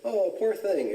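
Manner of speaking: high-pitched imitation of a sympathetic girl; Blanche. Girl pitying him for missing breakfast, imitated high sympathy. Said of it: "And she said".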